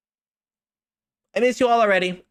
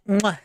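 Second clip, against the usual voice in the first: kiss sound; 2 semitones higher than usual. Real, not acted. Streamer blowing a kiss.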